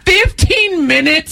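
high, slow disbelieving question; can't believe what they heard; incredulous. Real, not acted. Disbelief at the guess.

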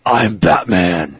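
slow, low Batman growl. Retold or imitated. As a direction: deliver it like the Dark Knight. Batman catchphrase impression.

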